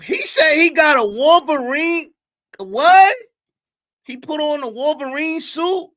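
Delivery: high, loud and slow, totally baffled; stunned disbelief. Real, not acted. Streamer reacting to the Wolverine suit claim, stunned disbelief. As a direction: cry out in confused shock.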